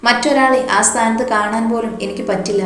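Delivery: usual speaking voice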